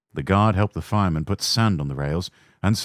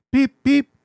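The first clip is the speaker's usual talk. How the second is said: high whistling "beep, beep", loud and higher; engine whistle; 6 dB louder than usual. A narrator's reading. Little engine whistling a warning at the station, high and loud. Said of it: "we whistle".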